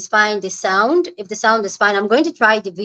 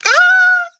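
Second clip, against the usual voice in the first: a shrill-free burst of delight, much higher and louder; high joyful squeal; 6 dB louder than usual. Real, not acted. Host greeting her live chat, squealing with delight. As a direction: squeal with joy at the viewers arriving.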